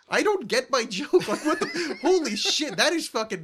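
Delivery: high, laughing disbelief; mock exasperation. Host mocking his own obscure joke, laughing exasperation. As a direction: retell it baffled, laughing at yourself.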